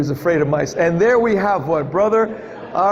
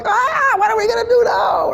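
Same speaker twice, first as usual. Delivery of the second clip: panicked cry. Retold or imitated. Preacher voices a disciple in the sinking boat, panicked shout. Terrified in storm-tossed boat.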